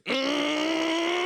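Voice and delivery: high, loud, long engine whine; mock scream like a race car; race car engine yell. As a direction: imitate a screaming girlfriend as a revving engine. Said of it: "she sounds like a race car engine".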